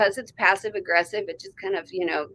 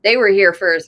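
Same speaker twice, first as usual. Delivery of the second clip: ordinary talk, nothing marked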